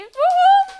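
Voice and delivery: very high, loud whooping woo-hoo; real cheer. Real, not acted. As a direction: whoop for the team in the room. Cheering for the team.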